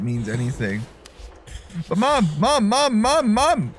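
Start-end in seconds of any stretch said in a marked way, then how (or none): 1.9-3.7 high, insistent repeated calling for mom; pestering child's call. Pretend. Calls repeatedly for game mother.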